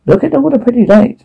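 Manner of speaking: high-pitched distorted character voice; distorted Poker. A narrator's reading. Poker calling attention to the pretty lights, in a high distorted voice. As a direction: read Poker's line up high and strange. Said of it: "Poker distorted".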